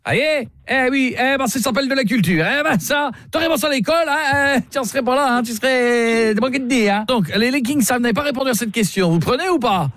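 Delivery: hammed-up snooty client lecturing the hotel clerk; mock-offended caller. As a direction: scold him like a snooty know-it-all.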